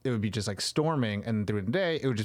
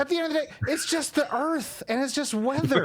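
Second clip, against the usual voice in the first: much higher, exasperated pleading; 12 semitones higher than usual. Pretend. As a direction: throw up your hands in disbelief. Exasperated rant, high.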